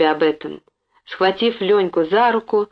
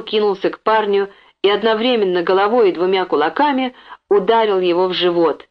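ordinary delivery, nothing marked

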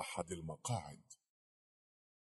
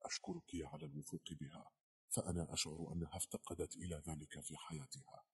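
hushed voice; 2 semitones lower, 8 dB quieter than usual. A narrator's reading. Langdon thanks a colleague for trusting her, hushed. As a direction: speak it softly, almost confidingly. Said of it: "قال لانغدون بصوت خافت".

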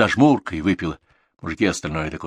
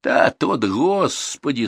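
high, stretched-out lament; loud, rueful dismay; distressed exasperation; 8 semitones higher, 4 dB louder, much slower than usual. A narrator's reading. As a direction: wail it as if despairing. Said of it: "сокрушённо махнул рукой Дуков".

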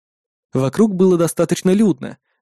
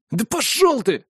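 hateful yell; 8 semitones higher, slower than usual. Acted. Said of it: "заорал один из них с ненавистью".

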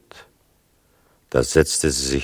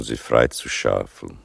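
ordinary delivery

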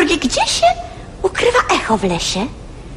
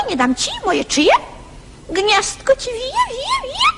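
high squabbling bird chatter; 12 semitones higher than usual. Acted. All the birds squabbling over scraps, high and excited. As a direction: chatter shrilly like a flock. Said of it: "wszystkie ptaki w szczepiot, w świegot".

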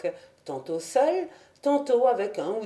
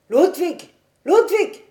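crier très fort et aigu; héler un enfant depuis la fenêtre; cri d'appel; 10 semitones higher, 8 dB louder than usual. A narrator's reading. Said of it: "il se mit à crier". (appel crié, le second encore plus aigu)

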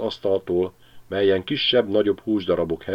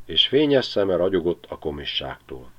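ordinary delivery

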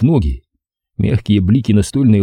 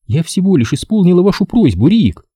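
put-on innocent girl voice; 9 semitones higher than usual. A narrator's reading.

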